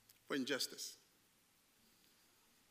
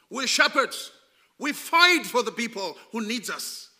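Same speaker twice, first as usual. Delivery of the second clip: loud, rousing sermon cry, much louder; preaching at full force; 4 semitones higher, 9 dB louder than usual. Acted. Preacher rallying his church as warriors, loud fiery preaching. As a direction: drive it home like a fiery preacher.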